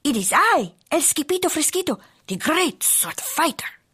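put-on high, grand Spanish-accented hero voice; Skipito calling out. A narrator's reading. Sword fighter hailing hidden amigos, calling out grandly. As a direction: announce yourself like a swashbuckler. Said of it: "called out Skippy John Jones".

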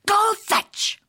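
high, sharp doggy command; barked order. A narrator's reading. Poodle principal throws balls, barking the order. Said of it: "barked Missus Begalot".

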